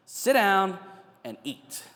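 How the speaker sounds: Sit down said loud and high, and eat let fall almost to a whisper; firm calming command. Retold or imitated. Jesus settling an anxious human being, firm then hushed. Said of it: "Jesus knows exactly how to calm down a human being".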